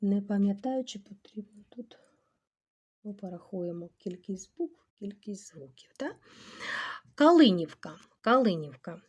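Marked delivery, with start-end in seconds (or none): 0.0-6.2 hushed, half-whispered aside; very soft, trailing into near whisper; muttering to self. Real, not acted. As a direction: think aloud under the breath. Teacher muttering to herself while checking.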